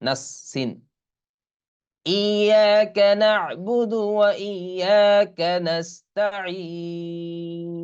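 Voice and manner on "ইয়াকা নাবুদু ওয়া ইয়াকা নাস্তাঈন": slow, drawn-out Quranic chant with long vowels; Quran recitation. Shown as a demonstration. Teacher models the verse for students, slow tajweed chant. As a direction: chant it slowly like tilawat. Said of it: "teacher recites Fatiha verse".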